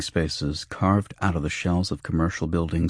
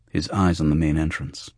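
plain reading voice

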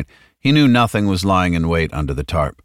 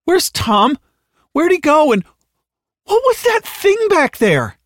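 very high, near-hysterical demands; frantic questioning; 5 dB louder than usual. Acted. Halliday squirming in the back seat, frantic. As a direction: climb into shrill panic, slowing on the last question. Frightened passenger growing more frantic.

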